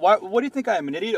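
normal talking voice

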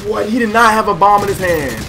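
loud, high, indignant protest; angry outburst. Real, not acted. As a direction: yell it in disbelief. Angry outburst at a teammate's kill.

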